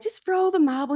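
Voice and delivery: high-pitched coaching instruction; coaxing coach. Retold or imitated. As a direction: coax a reluctant client, pitched well up. Coaching voice in retold scene.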